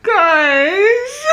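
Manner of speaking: high wailing laugh through tears; laugh-crying. Real, not acted. Reader to viewers, laugh-crying over the book. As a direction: break into a helpless laughing sob. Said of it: "I am unwell".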